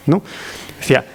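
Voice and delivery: ordinary delivery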